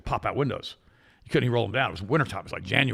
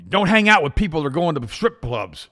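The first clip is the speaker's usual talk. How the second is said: emphatic rule; 7 semitones higher, 8 dB louder than usual. Retold or imitated. Retold chat, listing rules.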